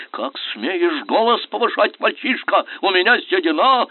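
high, loud indignant rebuke; outraged shout. A narrator's reading. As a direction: put the young upstart down. Prince yells back at him. (возмущённый крик)

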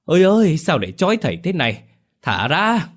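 tied-up exorcist howling to be set free; howling cry. Acted. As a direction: howl it out high and loud like a lone wolf. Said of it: "rú lên như chó sói cô đơn".

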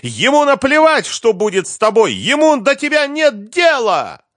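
very high, loud screamed accusations; spitting rage. A narrator's reading. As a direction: shriek against the father to his child. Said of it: "брызгала слюной Лиза".

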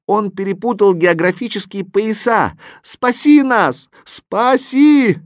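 high, loud, slow desperate cry; desperate pleas. A narrator's reading. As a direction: beg to be saved. Animals desperately beg for rescue.

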